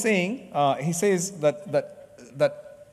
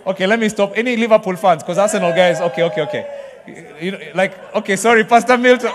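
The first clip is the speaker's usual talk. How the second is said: loud, laughing banter with the crowd; laughing tease; 4 semitones higher, 9 dB louder than usual. Real, not acted. Preacher teasing Arsenal fans in the church, laughing loudly.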